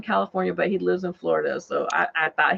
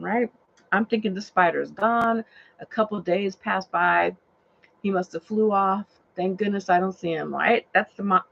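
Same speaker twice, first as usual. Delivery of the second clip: usual speaking voice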